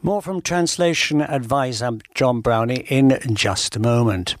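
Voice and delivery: normal talking voice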